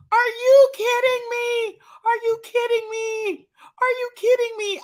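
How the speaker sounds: high, loud disbelief; incredulous. Acted. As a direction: repeat it in outraged disbelief. Disbelief at melted bag.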